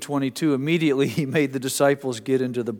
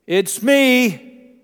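loud, much higher shout over wind; yelling; 12 semitones higher, 10 dB louder than usual. Retold or imitated. Jesus yelling to frightened disciples in the storm. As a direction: call out across the storm. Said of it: "He's yelling to them".

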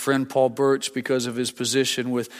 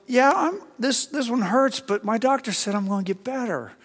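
high-pitched, acted-out happy reply; playfully delighted inner voice; cheerful insistence; 9 semitones higher than usual. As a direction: insist brightly through the pain, voice pitched up. Acts out his happy inner reply.

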